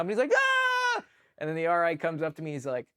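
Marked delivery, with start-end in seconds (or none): 0.3-1.0 very high imitated scream; mock scream of waking in shock; startled scream. Sleeping private jolted awake by explosives, high imitated scream. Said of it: "is screaming, waking up".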